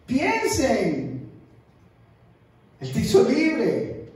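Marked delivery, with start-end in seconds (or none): none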